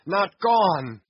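loud desperate denial; frantic cry. Acted. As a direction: shout it, refusing to believe.

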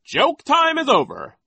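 very high, loud, slow proclamation; Nero's announcement. A narrator's reading. Nero ends the children's joking, high announcement. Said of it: "Nero announced".

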